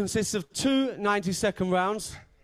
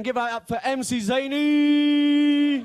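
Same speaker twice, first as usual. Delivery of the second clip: long, stretched, loud higher announcer call with the name held out; drawn-out hype announce; 6 semitones higher, 5 dB louder, slower than usual. Real, not acted. Host hyping the crowd for the first battler, big drawn-out announce.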